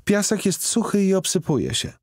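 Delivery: put-on high child voice, offhand; nonchalant girl. A narrator's reading. Girl brushes off the man's sandcastle idea, in a nonchalant child's voice. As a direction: toss it off like a bored kid. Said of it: "rzekła nonszalancko".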